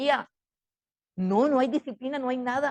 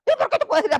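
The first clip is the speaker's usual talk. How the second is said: high, loud mock protest; raised-pitch backtalk; protesting child; 9 semitones higher, 5 dB louder, faster than usual. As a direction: argue back like an indignant kid. Voices child arguing back with mother.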